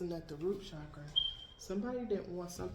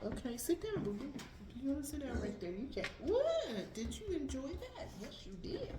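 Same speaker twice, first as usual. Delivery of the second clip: pet talk; 6 semitones higher than usual. Real, not acted. Talking to her pets.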